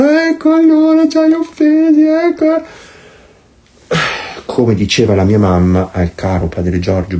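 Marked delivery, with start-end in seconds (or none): 0.0-2.6 high, whiny pouting voice; mock offended whine, much higher; sulky. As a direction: sulk like an offended child. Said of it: "col broncio".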